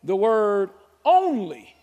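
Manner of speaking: "only" slow and loud, set apart; stress on only. Shown as a demonstration. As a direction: lean hard on "only". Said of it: "make something stand out".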